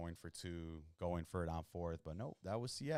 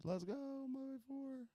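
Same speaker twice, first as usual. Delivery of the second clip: high hype squeal; excited cheer; slower than usual. Real, not acted. Hype cheer while winning.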